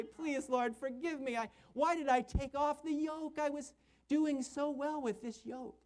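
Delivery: mock tearful plea in a high voice; whimpering, pleading. Backslider begging the Lord's forgiveness, mock whimpering. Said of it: "like a crying little puppy".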